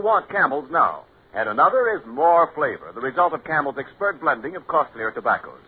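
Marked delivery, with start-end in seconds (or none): none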